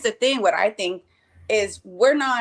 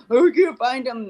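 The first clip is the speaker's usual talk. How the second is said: louder mock-sad complaint; imitated sadness; 2 semitones higher, 4 dB louder than usual. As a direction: imitate an upset child fretting. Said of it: "don't stay stuck on this sadness".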